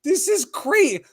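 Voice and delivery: stunned fan blurting out; amazed disbelief. Retold or imitated. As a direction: gasp out the wonder at the crazy play. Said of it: "I was like".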